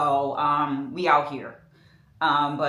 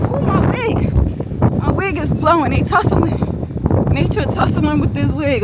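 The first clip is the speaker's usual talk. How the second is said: high, surprised squeal; startled; 8 semitones higher, 4 dB louder than usual. Real, not acted. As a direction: react to the wind grabbing the wig. Wind blowing her wig.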